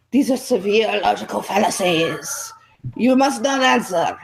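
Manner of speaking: lightly put-on French accent, prissy; a hint of a French lawyer objecting; bad French accent. Mocking a fussy French lawyer shutting down a question, in a bad French accent. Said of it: "I can't do French".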